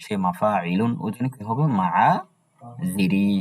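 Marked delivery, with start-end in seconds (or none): 1.8-3.3 মাআজিরি drawn out slowly, no ya sound; dropping the ya. Shown as a demonstration. Teacher models মাআজিরি for students, slow and careful. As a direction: stretch মাআজিরি out syllable by syllable, skipping the ya. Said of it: "ইয়াটা উচ্চারণ হবে না".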